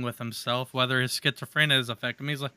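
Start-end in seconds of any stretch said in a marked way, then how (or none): none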